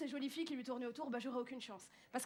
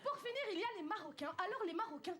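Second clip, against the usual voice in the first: ordinary talk, nothing marked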